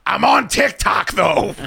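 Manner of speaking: slow, high call across a parking lot; high, drawn-out yell from the doorway. Retold or imitated. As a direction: shout it after a guy walking away. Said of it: "he yells out".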